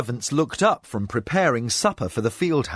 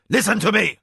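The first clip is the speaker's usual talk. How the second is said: loud, high sharp command; a harsh command from the spymaster, high and loud; commanding shout; 8 semitones higher, 5 dB louder, faster than usual. A narrator's reading. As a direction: make the servants flinch. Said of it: "in a tone that made the prone servants flinch".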